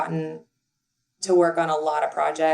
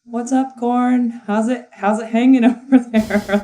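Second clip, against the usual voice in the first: higher, breezy what's up to a corn cob; laughing, higher-pitched buddy greeting to the corn; casual bro greeting; 4 semitones higher, 4 dB louder than usual. Retold or imitated. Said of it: "And I'm like".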